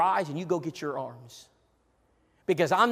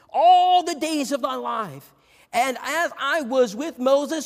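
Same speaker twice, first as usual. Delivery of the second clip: solemn scripture reading; 6 semitones higher, 3 dB louder than usual. Acted. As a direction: proclaim the verse like a prophet. Reads scripture in raised voice.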